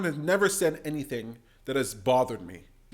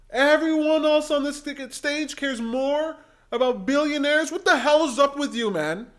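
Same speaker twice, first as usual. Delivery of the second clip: 1 loud outraged rant; 9 semitones higher, 4 dB louder than usual. Real, not acted. Mocking her claim loudly.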